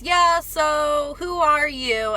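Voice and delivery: imitating the clerk. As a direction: draw the question out, curious and a little odd. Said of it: "he goes".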